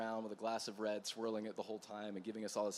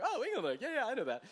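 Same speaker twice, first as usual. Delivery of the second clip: fake knowing; 6 dB louder, faster than usual. Pretending to know the winery.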